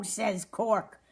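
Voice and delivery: usual speaking voice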